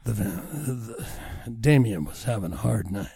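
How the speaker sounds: low, soft, sultry narration; sexy audiobook voice. Shown as a demonstration. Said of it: "you got a sexy voice".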